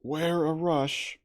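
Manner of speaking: loud, high, slow warning call; a drawn-out alarm call; warning shout. Acted. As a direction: shout a warning across the thicket. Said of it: "came the voice of Montgomery".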